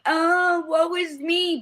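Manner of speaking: high whiny woe-is-me, hammed up; mock self-pity.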